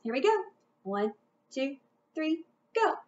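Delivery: ordinary talk, nothing marked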